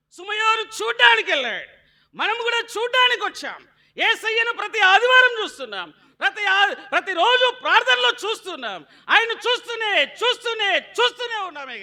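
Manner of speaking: high, loud shouted sermon; full-force pulpit shout; fiery preaching. Acted. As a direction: drive it home to the church.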